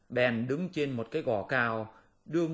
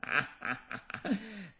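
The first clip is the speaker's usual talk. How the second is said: booming demon cackle; loud cackling laugh; 8 semitones higher, 4 dB quieter than usual. Acted. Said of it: "khanh khách cười to nói".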